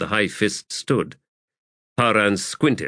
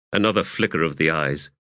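usual speaking voice

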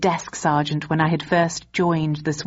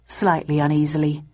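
ordinary delivery, nothing marked

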